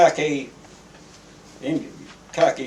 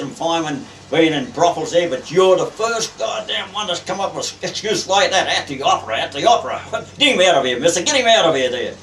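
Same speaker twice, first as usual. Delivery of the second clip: loud, high-pitched tirade building to a dismissal; outraged berating, then throwing him out; angry captain; 6 semitones higher, 6 dB louder than usual. Retold or imitated. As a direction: retell the captain blowing up in disbelief. Captain berates young seaman for excuse.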